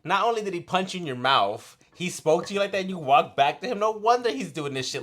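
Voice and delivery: loud, high-pitched indignant rant; outraged scolding. Retold or imitated. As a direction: lay into her in disbelief. Retold outraged scolding of the woman.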